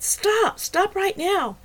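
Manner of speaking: very high, loud yell; shouting. A narrator's reading. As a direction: scream at them to stop. Heroine breaking up a fight.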